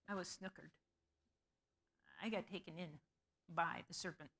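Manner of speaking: soft, low, embarrassed confession; rueful admission. Acted. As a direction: admit you were fooled, softly. Woman admits she was tricked.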